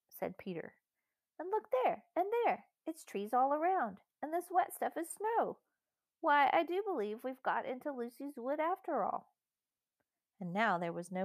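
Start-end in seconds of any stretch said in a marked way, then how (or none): 1.4-9.3 put-on high, excited child character; amazed child. A narrator's reading. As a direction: marvel in a child's high voice, pointing out each discovery. Excited discovery in story dialogue.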